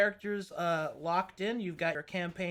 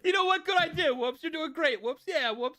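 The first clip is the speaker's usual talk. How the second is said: put-on lightly done Pikish accent, higher and quicker; a hint of rough Pikish accent, pitched up; playful Pikish accent, higher than usual; 9 semitones higher, faster than usual. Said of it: "That was me doing a Pikish accent".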